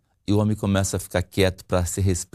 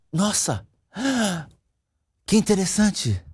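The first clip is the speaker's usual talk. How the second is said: higher, mock amazed reaction; demonstrated interest; 7 semitones higher, slower than usual. Shown as a demonstration. Husband shows wife he is listening, exaggerated interest. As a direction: show fascination with pauses between reactions. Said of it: "Ou então assim".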